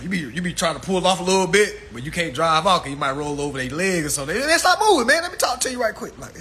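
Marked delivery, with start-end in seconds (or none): 4.4-6.2 high, loud mock driver voice; pushy coworker. Forklift driver stops comic to talk, high and pushy. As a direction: pin him down to talk.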